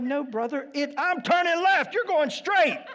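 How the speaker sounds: half-laughing protest at a waving driver; exasperated retort. Retold or imitated. Pastor retells shouting at a waving driver.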